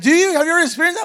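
hammed-up disbelief; amazed question. Pastor voicing the mother amazed he knows demons, high and loud. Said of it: "She's like".

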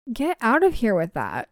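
ordinary delivery, nothing marked

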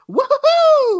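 very high, loud goofy cheer; over-the-top woohoo; mock hero whoop. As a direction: ham up Spider-Man's yell. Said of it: "in the Spider-Man's trailer".

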